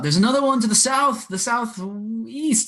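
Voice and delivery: high-pitched warning call, drawn out at the end; acted yell. Player's character warns of another one, yelling. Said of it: "he's gonna yell out".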